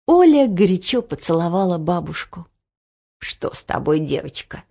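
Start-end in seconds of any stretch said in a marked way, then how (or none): 3.2-4.6 quiet concern. Acted. Grandmother concerned.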